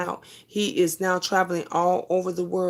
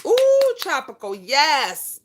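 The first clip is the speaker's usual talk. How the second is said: much higher, louder delighted ooh; excited squeal; 7 semitones higher, 4 dB louder, slower than usual. Real, not acted. Cheering a viewer's vacation news.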